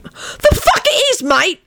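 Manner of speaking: very high, indignant shout; high outraged retort. A narrator's reading. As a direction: shout it in outrage, pitch shooting up. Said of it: "Sirius shouted".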